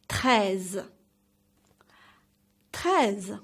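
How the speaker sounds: "Treize" with the r from the back of the throat; teacher's careful "Treize" with light final z; throaty French r. Shown as a demonstration. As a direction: roll "Treize" from the throat, slight z at the end. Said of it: "comes from the back of your throat".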